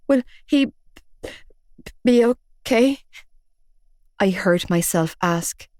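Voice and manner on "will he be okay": small, scared voice. A narrator's reading. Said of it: "feeling acutely small and young".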